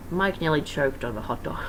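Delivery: ordinary delivery, nothing marked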